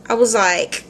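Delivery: ordinary talk, nothing marked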